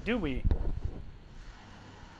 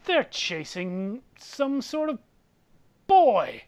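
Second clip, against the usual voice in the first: slow, higher, haughty drawl; arrogant rich man; 5 semitones higher, slower than usual. Acted. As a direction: draw it out with disdain, punching the last word. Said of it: "with an arrogant sort of air to his voice".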